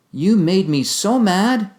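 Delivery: high, loud, slow accusing; exasperated blaming outburst; angry blame. Shown as a demonstration. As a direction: accuse them angrily. Acting out blaming quotes.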